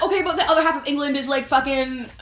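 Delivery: fast, high scornful; high, fast mock scorn; brash dismissal. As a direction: brush them off. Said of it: "she's like".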